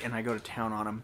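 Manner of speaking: normal talking voice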